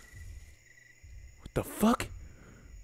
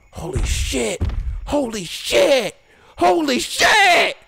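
rising panic; 7 semitones higher, slower than usual. Real, not acted. Player as the T-Rex finds him, building panic. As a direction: let fear swell each time.

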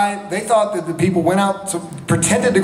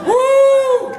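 high, loud whoop; sustained high-pitched "woo"; whooping cheer; 5 dB louder than usual. Real, not acted. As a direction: let out a school-spirit whoop. Cheering for shared high school.

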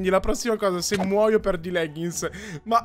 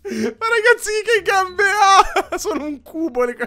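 laughing helplessly, high; trying not to laugh; 10 semitones higher than usual. Real, not acted. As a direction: break up laughing mid-sentence. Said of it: "me la faccia mi deve far ridere".

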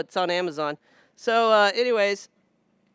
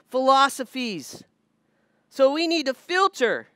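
raised, emphatic sermon voice; preaching; 4 semitones higher than usual. Acted. Pastor warns the congregation about false teaching, raised and emphatic. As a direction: drive the lesson home from the pulpit.

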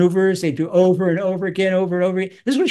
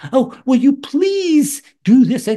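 higher, drawn-out, exaggerated pleading; mock nice request; 5 semitones higher, 4 dB louder, slower than usual. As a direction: ask with hammed-up politeness. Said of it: "do not talk to you nicely".

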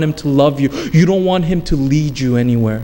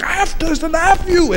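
high, squeezed-up imitation of screaming; mock scream. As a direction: act out screaming curses without full volume. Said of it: "he was screaming it out".

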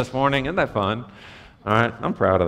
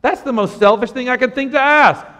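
higher, indignant scold; mock outrage; 12 semitones higher, 5 dB louder than usual. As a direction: act shocked at a selfish request. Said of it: "they're not going".

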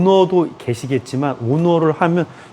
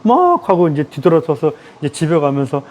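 ordinary talk, nothing marked

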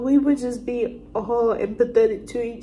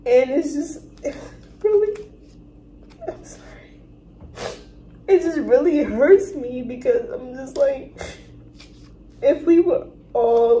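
real crying. Real, not acted. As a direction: fight through tears to get it out. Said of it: "That just made me so emotional".

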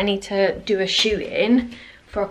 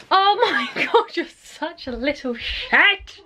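laughing; 6 semitones higher, slower than usual. Real, not acted. Laughing at Sprout.

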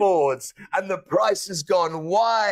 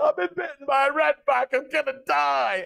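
higher, hammed-up panic to the doctor; mock panic; 7 semitones higher than usual. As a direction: play the terrified patient, half joking. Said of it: "I said".

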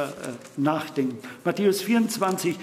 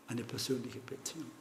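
hushed repeat; 4 semitones lower, 12 dB quieter than usual. Shown as a demonstration.

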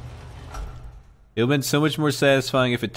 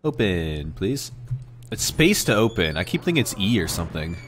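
normal talking voice

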